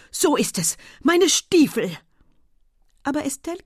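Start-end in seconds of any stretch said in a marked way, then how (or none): 0.1-2.0 loud, puffed exclamations rising higher; out of breath. A narrator's reading. Husband laments his boots, out of breath. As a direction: cry out while catching your breath. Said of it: "rief er ganz aus der Puste".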